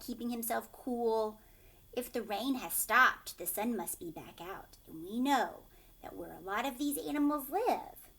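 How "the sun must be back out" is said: ordinary delivery, nothing marked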